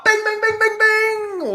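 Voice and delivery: high sung bing bing bell; mock quiz bell. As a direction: ring it like a game show bell. Quiz host buzzer sound effect.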